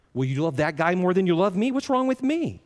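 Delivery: high, rising mock protest to God; wounded complaint. Acted hurt complaint to God.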